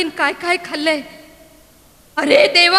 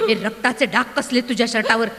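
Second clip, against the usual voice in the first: frightened hush; 8 semitones lower, 6 dB quieter, faster than usual. Acted. Mother sees blood on shirt.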